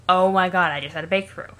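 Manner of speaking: ordinary delivery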